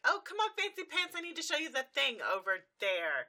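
high, loud, urgent put-on voice; hurried distraction. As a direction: pull him away in a rush. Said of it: "Rarity comes up and just like".

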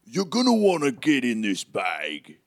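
loud giant voice falling in pitch; hulking giant. Acted. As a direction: announce it loudly as the huge stranger. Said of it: "hulking behemoth".

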